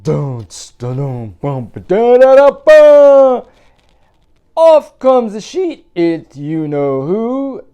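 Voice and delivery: very loud, high triumphant flourish; big drumroll reveal. Acted. Reader hits the ghost reveal loud and high.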